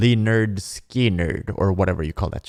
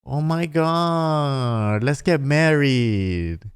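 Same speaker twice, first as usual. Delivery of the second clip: higher mock girly voice, words drawn out; hammed-up dreamy bride-to-be; swooning girl; 3 semitones higher than usual. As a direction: parody a girl melting at sweet promises. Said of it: "And then the girl is like".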